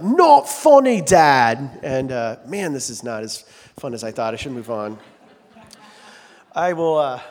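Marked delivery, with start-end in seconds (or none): 0.0-1.8 loud, high imitation, dropping on the last word; mock rock star put-down. Child mimicking a rock star at a parent, loud mock disdain. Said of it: "wander around the house being like".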